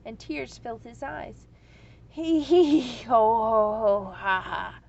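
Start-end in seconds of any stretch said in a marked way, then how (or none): 2.1-4.8 loud booming hee hee ho ha; roaring dragon laughter. A narrator's reading. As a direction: roar with helpless laughter. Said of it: "laughed the dragon".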